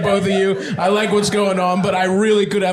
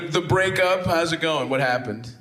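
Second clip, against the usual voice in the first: usual speaking voice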